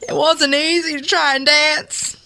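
pleading Southern mama, acted; tearful-edged Southern melodrama; Southern accent. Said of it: "do this in a Southern accent".

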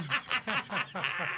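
real burst of laughter; helpless giggling laugh. Real, not acted. Host cracking up at the band's joke, laughing.

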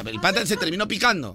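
ordinary delivery

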